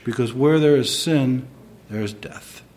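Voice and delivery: usual speaking voice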